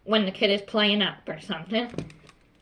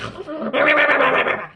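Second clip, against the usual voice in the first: loud, higher-pitched angry babble; exaggerated yelling noise; mock ranting gibberish; 5 semitones higher, 6 dB louder than usual. Shown as a demonstration. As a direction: blubber out a furious wordless rant. Mimics a ranting, screaming teacher.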